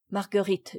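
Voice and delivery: plain reading voice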